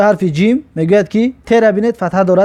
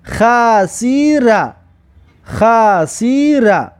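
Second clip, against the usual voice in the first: very slow, drawn-out syllables; slow teacher's model reading; much slower than usual. Shown as a demonstration. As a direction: stretch each syllable for learners to copy. Teacher models the blended word.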